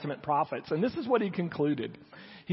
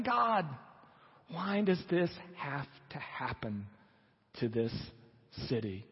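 pained lament; 6 dB quieter than usual. Acted. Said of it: "he's crying out and he's saying".

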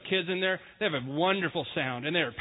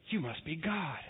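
awed dog; 8 dB quieter, slower than usual. Retold or imitated. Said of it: "The dog looks at you and goes".

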